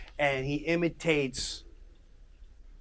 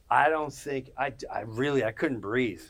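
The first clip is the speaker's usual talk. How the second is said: ordinary talk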